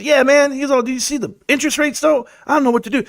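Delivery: a hint of a fretting cousin; imitating cousin.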